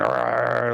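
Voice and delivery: mock monster "arr"; imitated spider snarl. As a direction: growl it as the menacing spider. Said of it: "He was staring by the garden door going".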